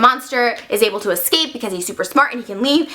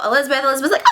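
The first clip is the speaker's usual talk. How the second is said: ordinary talk, nothing marked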